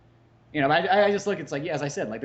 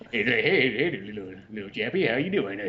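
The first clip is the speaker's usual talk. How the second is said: put-on sleazy old southern man sidling up, southern accent; southern creep accent; 2 semitones lower than usual. Military creep greets a Japanese schoolgirl, hammed-up southern accent. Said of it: "the pederasts have southern accents".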